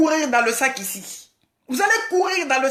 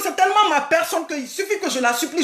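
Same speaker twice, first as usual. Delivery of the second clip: normal talking voice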